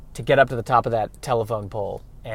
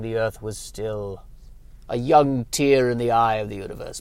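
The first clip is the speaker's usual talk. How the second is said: mystic elder sage; 4 dB louder than usual. Acted. Reverend Mother tells the child podcasting's cosmic history, hammed-up. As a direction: intone it like an ancient oracle, then wail.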